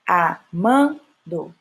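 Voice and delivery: Amando split very slowly, MAN strongest; drawn-out Amando with weight on the middle syllable MAN; stress on MAN. Shown as a demonstration. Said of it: "Man é a última sílaba forte".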